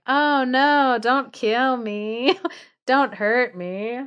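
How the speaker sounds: hammed-up bear begging not to be hurt; imitating the bear. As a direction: whine like a harmless giant. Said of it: "this big bear being like".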